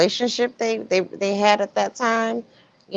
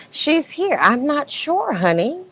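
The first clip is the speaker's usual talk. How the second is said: imitating Shirley; 7 semitones higher, 3 dB quieter than usual. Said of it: "she's like".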